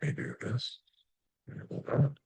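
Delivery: half-whispered muttering under breath; muttering to myself. Real, not acted. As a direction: mumble to yourself while fiddling with screens. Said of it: "I'm muttering to myself".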